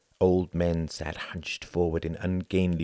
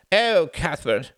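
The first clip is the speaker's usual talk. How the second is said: high, slow, louder moan; a weary plea for sympathy; 11 semitones higher, 7 dB louder, slower than usual. Acted. Sam complaining to Cuthbert about feeling put out to pasture, moaning. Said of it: "he moaned".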